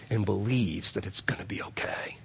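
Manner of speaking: preacher whispering the promise to the congregation; hushed whisper. Real, not acted. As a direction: let it drop to a breathy hush. Hushed climax of his point.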